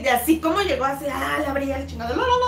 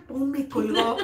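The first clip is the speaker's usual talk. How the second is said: usual speaking voice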